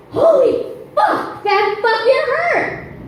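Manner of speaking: high, drawn-out pained cursing; acted cathartic outburst. Comic finally swearing over stubbed toe, high hammed-up release. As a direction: let the pent-up curse out at last. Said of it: "I let out".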